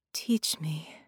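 breathy whisper; barely voiced plea; breathed. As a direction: Carolina begs to be taught in a breath. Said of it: "she breathed".

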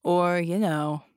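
slow, dismissive drawl; mock her failure slowly; sneering. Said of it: "the genie said, shaking his head".

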